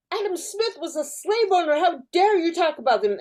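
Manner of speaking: high-pitched imitating accusation; hammed-up indignant outburst; mock yell. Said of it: "but yelling at people like".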